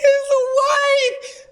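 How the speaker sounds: very high, crying wail; retold wail. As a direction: wail it as if in tears. Wailing the news to mom.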